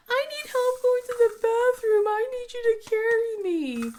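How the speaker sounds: put-on high, pleading little boy; whiny kid voice. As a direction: beg like a hurting child. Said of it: "Anyway, he goes".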